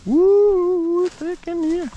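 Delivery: high-pitched whoop through brush. Real, not acted. Pushing through thick cover.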